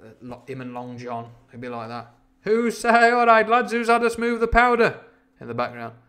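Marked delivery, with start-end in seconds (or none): 2.4-5.0 high, loud shout across the deck; calling out to the crew. Acted. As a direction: holler it to the men, pitched high. Voices sailor calling to crew.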